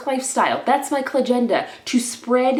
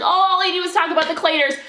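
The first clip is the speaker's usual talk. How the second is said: high, loud, fast whiny mimicry of the critics; mock high whine, louder and fast; mocking hater voice; 9 semitones higher, 6 dB louder, faster than usual. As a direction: sneer it like the people who mock her. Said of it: "And people be like".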